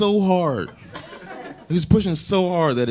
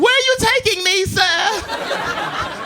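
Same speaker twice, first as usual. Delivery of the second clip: put-on high, posh little British child; British kid accent; much slower than usual. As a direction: ask it like a polite British kid. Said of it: "now they have British accents".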